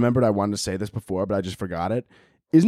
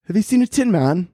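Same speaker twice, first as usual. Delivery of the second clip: high, mock-British Dorothy voice; light, raised British parody; 8 semitones higher, 4 dB louder than usual. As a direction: put on a British lilt as Dorothy. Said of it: "why is she British".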